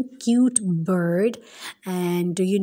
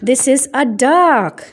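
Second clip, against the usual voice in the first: ordinary talk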